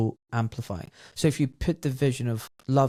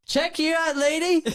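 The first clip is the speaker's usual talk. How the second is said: very high squeaky teasing voice; much higher, cheeky put-on voice; playful tease. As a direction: squeal it up high, mock-impressed. Teasing friend about psilocybin trip.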